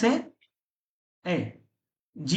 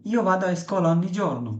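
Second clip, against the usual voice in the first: slow model sentence; much slower than usual. Shown as a demonstration. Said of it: "teacher models Italian sentence".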